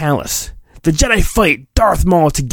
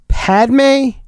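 squeaky character call; 9 semitones higher than usual. Acted. Character voice calling name.

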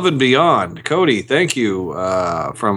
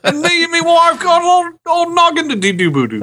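high, hammed-up British babble; mock British accent. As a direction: gibberish in a silly British accent. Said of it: "read it in a British accent".

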